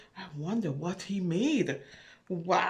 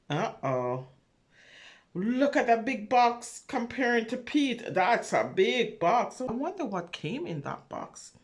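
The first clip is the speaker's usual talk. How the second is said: usual speaking voice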